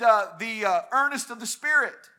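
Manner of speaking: ordinary delivery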